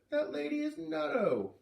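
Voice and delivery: put-on high, loud, upset boy's voice; a small boy blurting out his outrage; upset little boy. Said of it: "Luke said".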